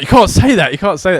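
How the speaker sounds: panicked telling off at work; shocked protest. Retold or imitated. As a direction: blurt it out in shock. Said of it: "I was like".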